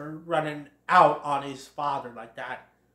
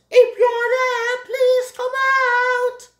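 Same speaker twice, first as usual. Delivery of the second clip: high, slow, drawn-out holler; shouting; 9 dB louder, much slower than usual. Acted. Thomas calls for hidden Bambi, long high shout. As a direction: call across a distance, stretching the words. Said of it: "Thomas shouts".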